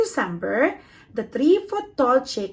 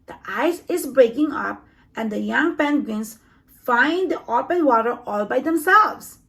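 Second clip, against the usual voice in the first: ordinary delivery, nothing marked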